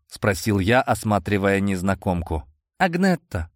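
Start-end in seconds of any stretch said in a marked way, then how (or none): 2.8-3.5 high squeaky answer; squeaky girl voice. A narrator's reading. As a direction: squeak out the name. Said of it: "пропищала та".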